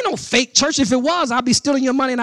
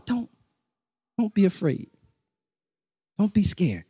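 softer and lower, tender and reassuring; loving father; 4 semitones lower, 6 dB quieter than usual. Shown as a demonstration. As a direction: comfort a frightened child like a caring father. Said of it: "a loving, caring, passionate, nurturing father".

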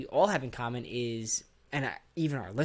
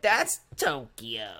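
foreigner voice; much slower than usual. Retold or imitated. Voicing a foreigner correcting Americans.

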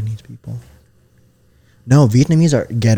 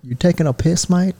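mock British question, pitched up; British accent; 6 semitones higher than usual. Shown as a demonstration. Host prompting friend to copy, playful British. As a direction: put on a British accent that slips toward Australian. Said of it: "something a British person would say".

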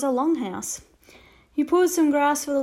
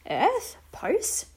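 puzzled echo; 7 semitones higher than usual. Acted. Boy echoes, puzzled.

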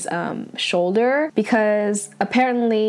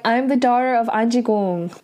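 usual speaking voice

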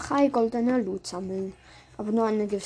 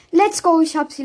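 excited cheer; 5 semitones higher, 9 dB louder than usual. Real, not acted. Grabs the orb, cheers.

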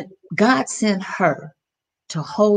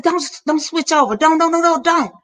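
high, urgent, louder begging not to hang up; desperate plea; 9 semitones higher, 3 dB louder, faster than usual. Retold or imitated. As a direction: plead with rising panic, fast. Mother begging son on phone.

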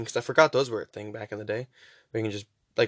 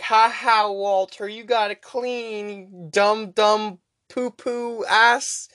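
higher, taunting bully voice, dragging out the insult slowly; mocking janitors; 8 semitones higher, much slower than usual. Janitors taunting Walter over his mopping, mock sneering. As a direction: sneer at him like a schoolyard bully.

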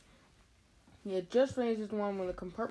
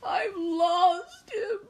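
very high, drawn-out loud wail; hammed-up crying, slow and shrieky; 12 semitones higher, 7 dB louder, slower than usual. Tearful boy wails over lost toad, high mock crying. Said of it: "he wailed".

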